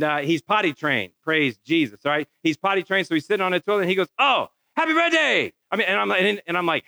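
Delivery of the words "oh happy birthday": put-on high, excited little-kid cheer; excited child. Little boy on the toilet greeting his dad, excitedly. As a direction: blurt it out gleefully, pitched way up.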